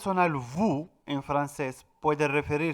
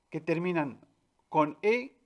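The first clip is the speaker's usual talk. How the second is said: ordinary delivery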